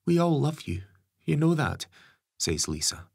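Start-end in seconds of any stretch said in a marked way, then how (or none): 0.1-1.9 higher gentle mother's voice; mum voice. Acted. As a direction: coax her son like a mum.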